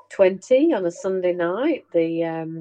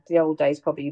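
usual speaking voice

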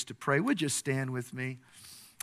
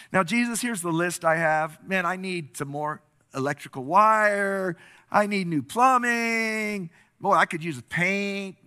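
raised, loud wish-list delivery; eager requests; 6 semitones higher, 6 dB louder than usual. Acted. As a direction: reel off demands to your guest, hammed-up. Dreamer eagerly reels off wish list.

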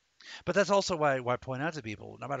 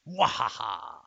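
mad scientist; 7 dB louder, slower than usual. Pretend. Villain laugh after the family versus Xenos.

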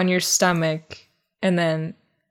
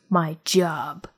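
mock cool whisper; 4 semitones lower, 6 dB quieter than usual. Imitating Bombay's cold reply.

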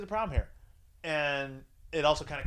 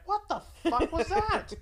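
much higher, slower mock disbelief with a laugh; stunned girlfriend; 3 dB louder, slower than usual. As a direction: play her baffled shock. Girlfriend's stunned reaction voiced.

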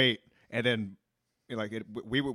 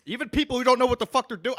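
usual speaking voice

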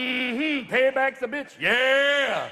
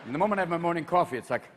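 ordinary talk

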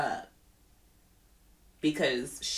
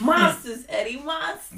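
loud New York cop imitation; New York accent; 7 semitones higher, 8 dB louder than usual. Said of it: "he had a thick New York accent".

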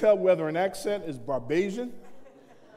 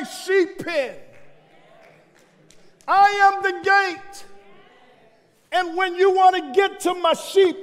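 raised, ringing sermon delivery; preaching; 7 semitones higher, 5 dB louder than usual. Real, not acted. Pastor telling the congregation Jesus is the gate, forceful preaching.

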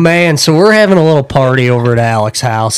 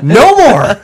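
higher, louder, laughing through the protest; laughing shout; 8 semitones higher, 5 dB louder than usual. Retold or imitated. Retelling the lap dance, he laughs out the protest. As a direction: yell it while cracking up. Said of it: "I shoot up and I go".

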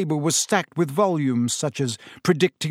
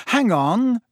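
higher, louder, hurried; quick and urgent; 8 semitones higher, 4 dB louder than usual. A narrator's reading. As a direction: cut in quickly to stop him. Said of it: "said Harry quickly".

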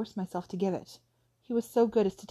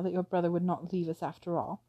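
ordinary delivery, nothing marked

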